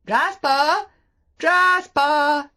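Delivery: loud, high calls; calling out. A narrator's reading. Maxim calling for the dog up the beach, loud call. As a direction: shout the name across the beach. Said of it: "called Maxim".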